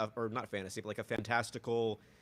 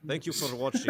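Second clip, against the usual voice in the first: a hammed-up Russian sign-off, louder; louder mock Russian accent; 4 semitones higher, 8 dB louder than usual. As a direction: say it like a joke Russian host. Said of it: "my Russian accent sometimes".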